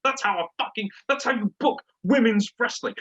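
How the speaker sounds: high-pitched, emphatic fan raving; worked-up retelling of his own cheering; retold fan outburst. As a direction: rave about it, pitch jumping way up. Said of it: "I'm like".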